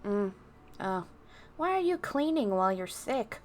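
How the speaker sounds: ordinary delivery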